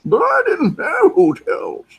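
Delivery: very high, loud raspy-style put-on voice; Andy Devine impression. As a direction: squeak it out like an old cowboy sidekick. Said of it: "He sounds like Andy Devine".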